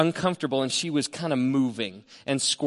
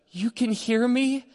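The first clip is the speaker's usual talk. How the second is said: amazed; 9 semitones higher, slower than usual. Retold or imitated. Wife waking from sedation, amazed.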